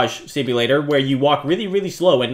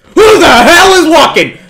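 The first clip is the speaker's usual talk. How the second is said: high, loud frightened shout; spooked by footsteps; startled yell; 11 semitones higher, 10 dB louder than usual. Real, not acted. Hears footsteps in the dark.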